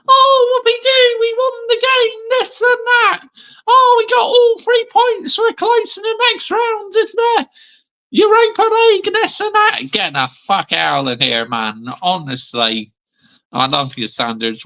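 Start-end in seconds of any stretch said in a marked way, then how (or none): 0.1-9.8 much higher, hammed-up giddy fan voice; mock a gushing fan celebrating the win; giddy fan mockery. Creator mocking giddy fans who celebrate, high silly voice. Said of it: "You're not gonna see me get thinking".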